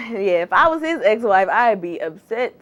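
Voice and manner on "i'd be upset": usual speaking voice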